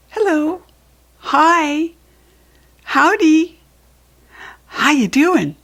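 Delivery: cheerfully trying every greeting; eager greetings. Acted. Claudette greeting the smoke clouds, eager high hellos.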